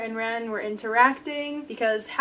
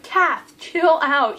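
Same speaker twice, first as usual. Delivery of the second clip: loud, higher exasperated call; raised-voice playful telling-off; mock scolding; 4 semitones higher, 8 dB louder, slower than usual. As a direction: tell a character off in mock exasperation.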